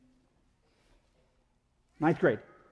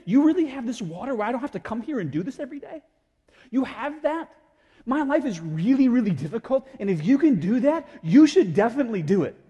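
higher, eager and insistent; acted eager plea; 4 semitones higher, 5 dB louder than usual. Weary woman asking Jesus for water, acted eager plea. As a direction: build from disbelief into louder urging.

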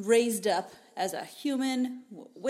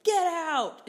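shouting; 9 semitones higher, 7 dB louder than usual. Retold or imitated. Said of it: "Pharaoh's like".

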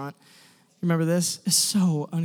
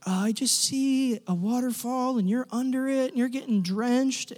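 imitating prophetic sharer; 4 semitones higher than usual. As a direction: stretch it out, pitched up, as if receiving a picture. Acting out tentative prophetic sharers.

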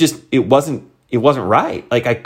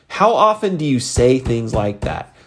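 ordinary delivery

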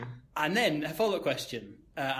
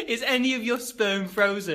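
louder, higher, slower disbelieving repeat; higher, louder, slower retelling in disbelief; incredulous disbelief; 8 semitones higher, 6 dB louder, slower than usual. Retold or imitated. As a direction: say it again as if still stunned. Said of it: "She asked me".

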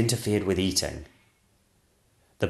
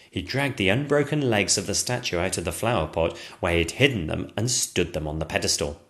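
usual speaking voice

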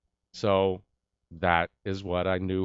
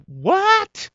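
shrieked, much higher and louder what; his own stunned what on hearing the news; 9 dB louder than usual. Retold or imitated. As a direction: blurt it out in total shock. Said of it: "in my own I've just seen a ghost voice".

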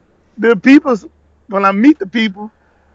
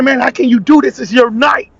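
higher-pitched imitated reproach; retold scolding; 4 semitones higher, 3 dB louder than usual. Manager berating cook in front of mom, scolding. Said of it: "why are you talking like this".

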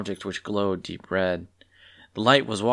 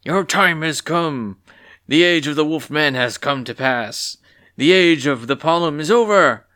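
grand robed figure; 5 semitones higher, 5 dB louder than usual. Acted. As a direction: declare the end of an age. Robed figure proclaims new age.

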